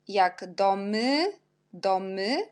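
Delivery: domy said slowly, y as an open E; teacher modelling the y in domy slowly; slow demo. Shown as a demonstration. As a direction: draw out domy for the class. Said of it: "on prononce E".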